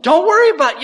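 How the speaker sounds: very high, loud mock outburst; hammed-up indignant yelp; incredulous echo. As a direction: throw the reassurance back in disbelief. Student throws the words back incredulously.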